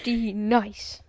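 mock mispronounced name, drawn out; comedy skit quote. Host echoing cohost's skit joke, playful imitation. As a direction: imitate the skit's botched roll call. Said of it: "that skit, bro".

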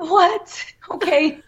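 high-pitched, laughing mock disbelief; retold stunned reaction. Fan retelling her reaction to the star, giggly disbelief. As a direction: replay being floored, high and giggly.

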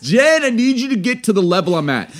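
ordinary delivery

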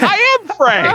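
proud reveal in a cartoonish high character voice; Frank voice. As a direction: announce yourself as Frank in his voice. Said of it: "I was like".

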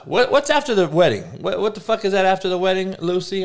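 high-pitched frustrated question; exasperated asking. Real, not acted. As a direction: demand the word, pitch up and fast. Frustrated, asking what it's called.

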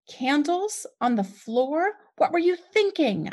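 slow, rising into a high, angry yell. A narrator's reading. Mother scolding her son over the candles, angry yell. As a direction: scold him hard. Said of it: "I yelled".

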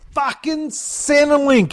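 high, slow drawn-out yell. A narrator's reading. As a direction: stretch the shout out. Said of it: "Sheila yelled at the top of her lungs".

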